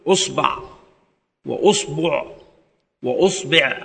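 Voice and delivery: أصبع with damma on the hamza, ba said first with a, then u, then i; أصبع said as usbaʕ, usbuʕ, usbiʕ; vowel variants. Shown as a demonstration. Teacher demonstrating the damma forms, careful articulation. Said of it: "ويجوز في الباء ثلاثة أوجه أيضًا".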